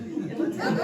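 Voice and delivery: high, loud, laughing through it. Retold or imitated. Mother teasing her kids about a family meeting, laughing. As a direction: giggle the invitation out. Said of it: "I said".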